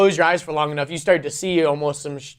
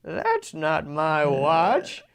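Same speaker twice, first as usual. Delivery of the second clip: put-on old grandpa voice; 4 dB quieter, much slower than usual. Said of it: "he goes".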